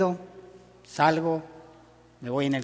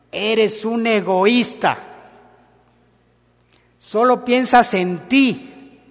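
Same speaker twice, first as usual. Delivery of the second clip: slow, high, louder accusing tone; mock reproach; 6 semitones higher, 7 dB louder, slower than usual. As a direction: scold him as selfish, drawn out. Lo que Rebe nunca diría. (reproche fingido, lento y agudo)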